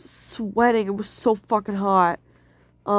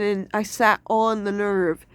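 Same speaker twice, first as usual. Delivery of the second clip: ordinary talk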